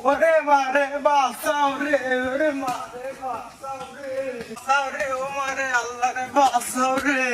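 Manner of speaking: slow, long-drawn cries of terror; wailing for help. Acted. Victim calling out to be rescued, desperate wail. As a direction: stretch each plea into a desperate wail.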